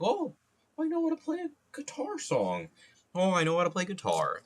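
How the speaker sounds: very high-pitched mock beginner guitarist; mocking quote. Streamer mimicking someone who learned one guitar song, mocking high voice. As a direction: parody a proud novice in a squeaky falsetto. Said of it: "on the guitar and be like".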